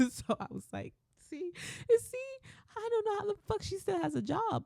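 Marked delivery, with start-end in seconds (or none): 1.3-4.6 high-pitched incredulous squeal; squeaky, mock-exasperated "told you so"; retold exasperated disbelief. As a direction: pitch it way up, venting to an ally. Own retold incredulous reply to manager.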